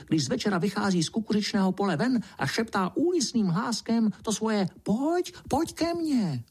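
very high little mouse voice; high-pitched mouse telling a spooky rumour; excited field mouse. A narrator's reading. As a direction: chatter on as a tiny field mouse. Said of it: "vykřikla rezavá polní myška".

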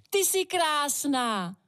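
high, drawn-out cry of admiration; exclaiming. A narrator's reading. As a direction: burst out with it, stretching the words. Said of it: "nemohla si pomoci a vykřikla".

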